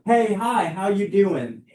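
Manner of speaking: high, cheerful hello to a stranger; bright greeting. Shown as a demonstration. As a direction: greet them upbeat and friendly. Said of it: "It can be that simple".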